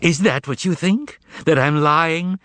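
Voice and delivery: the cat protesting he is no liar; indignant exclaim. Acted. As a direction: protest your honesty in high indignation. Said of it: "exclaimed the cat".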